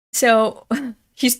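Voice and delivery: ordinary delivery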